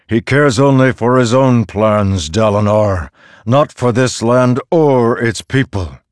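loud, high, booming accusation; angry argument. A narrator's reading. Storm god condemning Wit to Dalinar, angry. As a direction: push the accusation out forcefully.

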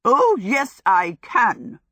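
loud, fierce comeback; fierce crackle. A narrator's reading. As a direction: snap back like a scolding sister. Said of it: "Sophie crackled back with the ferocity".

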